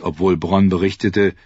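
usual speaking voice

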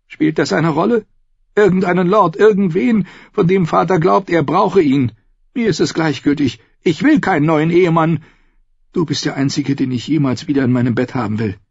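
high woman's voice, impassioned; impatient, passionate sister. A narrator's reading. Sister refuses a new husband, passionately insistent. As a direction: push back in a high, insistent female voice.

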